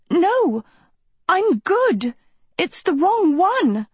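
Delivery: high, desperate screamed protest; screaming. A narrator's reading. Sophie screams at the bird over evil's towers, desperate scream. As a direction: scream the protest, pitched far up. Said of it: "Sophie screamed".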